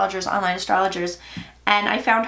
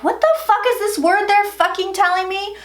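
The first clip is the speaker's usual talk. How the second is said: higher-pitched, baffled retelling of the moment; baffled outburst; 9 semitones higher, 3 dB louder than usual. Retold or imitated. Baffled by words the messages kept giving her, retold high. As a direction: toss it up high in disbelief. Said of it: "I was like".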